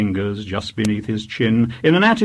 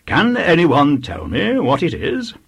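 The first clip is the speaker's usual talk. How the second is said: lightly voiced vicar, pitched up; vicar quizzing infants; 6 semitones higher than usual. A narrator's reading. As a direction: put the question to a class of small children.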